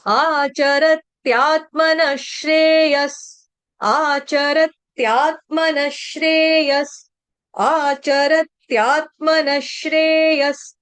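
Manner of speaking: आचरत्यात्मनः श्रेयस said slowly, every syllable separate; slow recitation. Shown as a demonstration. Teacher drilling the line for students to repeat. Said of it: "recites for students".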